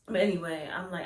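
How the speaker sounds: ordinary talk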